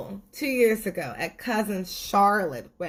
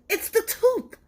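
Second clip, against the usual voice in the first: much higher, cheeky squeak; sweet tooth voice. Acted. Tooth's gleeful reply.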